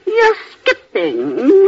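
high, drawn-out querulous whine; complaining whine. Acted. Invalid aunt scolds her companion, high, slow and whining.

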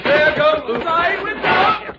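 high, loud, stretched-out cry; alarmed shout. Acted. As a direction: call out over the brawl. Calling out mid-brawl.

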